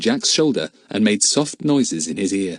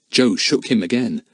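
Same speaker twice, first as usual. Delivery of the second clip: ordinary delivery, nothing marked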